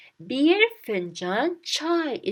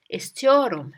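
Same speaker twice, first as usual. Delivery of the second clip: ordinary delivery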